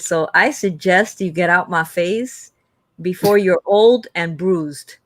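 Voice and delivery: slow, deliberate warning; slow threat. Retold or imitated. Warning the lady off, slow measured threat. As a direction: spell it out slowly. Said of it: "I said".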